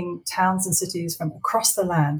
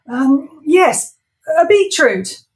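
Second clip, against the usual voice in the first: high, loud blurted answer; flustered [name]; 9 semitones higher, 8 dB louder, slower than usual. Acted. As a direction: blurt the first thing. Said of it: "said the first thing that popped into his head".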